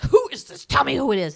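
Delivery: imitated angry mother, high and loud; mad mom. Angry mom answering a prank call, imitated in a high, loud voice. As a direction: demand it loudly in a mock-furious mom voice. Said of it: "his mom got so mad".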